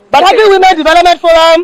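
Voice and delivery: calls the group name out loud and high; rallying call. Real, not acted. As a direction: call out to the crowd like a rally leader.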